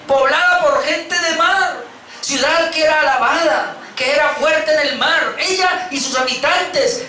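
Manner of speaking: high, slow lament over the fallen city; mournful dirge. Acted. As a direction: intone it like a funeral song. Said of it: "levantarán sobre ti endechas".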